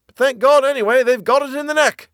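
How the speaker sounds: very high, loud yell; relieved yelling. A narrator's reading. Tom to Bill as the enemy is beaten, yelling. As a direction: shout it triumphantly over the battle. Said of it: "Tom yelled back".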